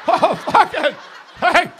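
high, loud startled cry; scared laugh at the threat; startled laughing yelp. Retold or imitated. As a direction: yelp in mock alarm.

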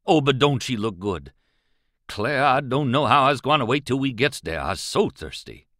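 anxious companion pleading in Southern dialect; Jim. Acted. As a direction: swing from worried plea to longing, falling away on the address.

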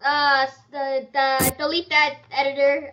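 loud flustered begging; panicked plea. Real, not acted. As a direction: blurt it out in a panic.

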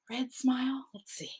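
whispered question to herself; soft whispered "red smile?". Real, not acted. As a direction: breathe it, unvoiced and curious. Wondering to herself while drawing.

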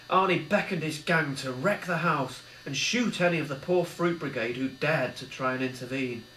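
usual speaking voice

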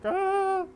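high imitated scared shout; imitated scream. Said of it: "so I'm like".

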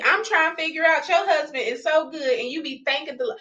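ordinary delivery

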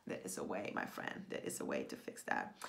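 very low, creaky hush; hushed reassurance. Real, not acted. Coach to fellow writer about fixing that, hushed reassurance. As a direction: drop right down, confiding softly.

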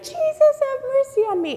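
extremely high, loud holler; desperate yelling. Acted. Blind man refusing to be silenced, very high yell. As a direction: shriek the plea to be heard. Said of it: "And he's like".

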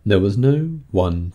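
usual speaking voice

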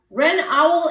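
ordinary delivery, nothing marked

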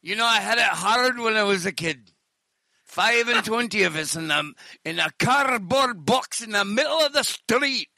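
hammed-up Scottish hard-luck tale; Scottish accent. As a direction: recount childhood misery in a broad Scottish accent. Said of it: "it sucks to be Scottish".

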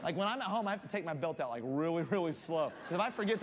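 ordinary talk, nothing marked

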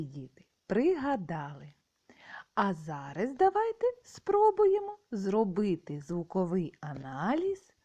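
ordinary talk, nothing marked

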